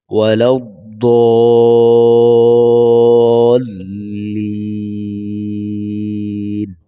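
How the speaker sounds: ওয়ালাদ-দাল্লিন with the আলিফ held four beats; long elongated vowel. Shown as a demonstration. Teacher showing a four-count vowel stretch. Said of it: "এখানে চার আলিফ".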